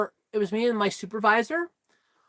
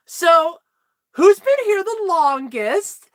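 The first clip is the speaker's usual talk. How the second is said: mock boss voice; 6 semitones higher, 3 dB louder, slower than usual. Boss asks staff in circle.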